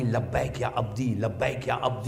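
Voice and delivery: a soft, close reply from God; tender answer. Acted. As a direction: answer gently, as to a dear sinner. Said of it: "آج موسیٰ کا mood change ہوا".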